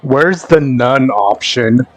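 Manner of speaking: ordinary talk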